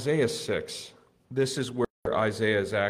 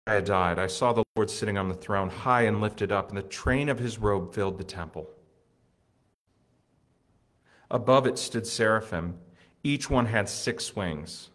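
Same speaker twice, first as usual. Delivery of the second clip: louder, measured Bible reading; scripture reading; 5 dB louder than usual. Acted. Pastor reads Isaiah's vision aloud to congregation, solemn and loud.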